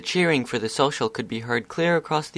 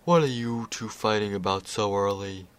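sleepy Discord. Acted. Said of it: "the sound of a drawn-out yawn".

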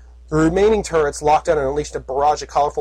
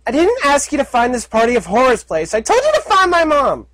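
muffled; 11 semitones higher, 5 dB louder than usual. Acted. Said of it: "said a muffled voice from under the ruins".

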